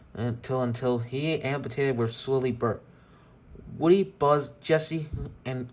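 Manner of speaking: usual speaking voice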